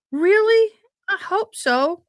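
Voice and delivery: high, loud, playful hope; mock eager squeal. Real, not acted. Joking about wanting emails from professors, mock eager squeal. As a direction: ham up being thrilled. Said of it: "joking about professor emails".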